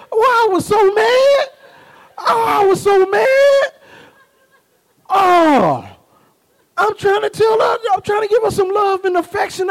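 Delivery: high, loud, hammed-up wail of hurt; mock anguished wail. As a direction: wail it like a wounded father playing for laughs. Comic hurt father's complaint.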